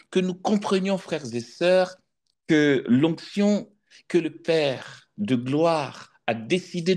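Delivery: soaring preacher's voice to the congregation; rising sermon. Acted. Preacher exhorting brothers and sisters on the anointing, fervent. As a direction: lift the words over the assembly, fervent.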